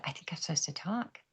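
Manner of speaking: whispering it to the pastor; a secret whisper, voiceless; hushed confession to the pastor. Retold or imitated. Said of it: "I said".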